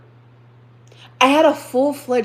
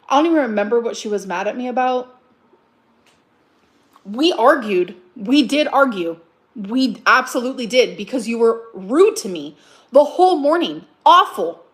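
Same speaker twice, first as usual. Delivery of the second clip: ordinary delivery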